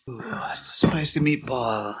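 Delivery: breathy mock whisper; jokey whispered catchphrase. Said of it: "whispered joke line".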